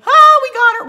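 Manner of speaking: high, loud triumphant crow; squealing we-got-her victory cry; gleeful gotcha. Retold or imitated. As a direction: cheer it like you won the bet. Family gloats that she cried.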